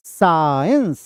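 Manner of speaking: SHINES drawn out, falling; stressed syllable demo. Shown as a demonstration. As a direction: stretch the vowel of SHINES. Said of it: "ये आपका हो गया इस test". (shines को खींचकर बोलें)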